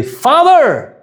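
high loud call of Father; boy calling his busy dad. Acted. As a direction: shout Father across the room. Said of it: "eu falei".

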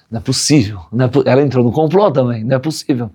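ordinary talk, nothing marked

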